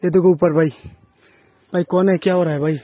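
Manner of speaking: ordinary talk, nothing marked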